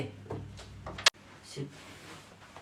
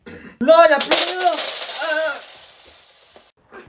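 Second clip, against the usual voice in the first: put-on high, loud squeaky kid voice; high whiny kid; 10 semitones higher, 7 dB louder, much slower than usual. Robbed kid whining to the toll guys, high and loud. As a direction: whine it out like a robbed child.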